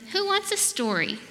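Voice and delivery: high, singsong invitation; storybook mommy. A narrator's reading. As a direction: pitch it up sweetly, like a parent offering storytime. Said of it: "and Mommy said".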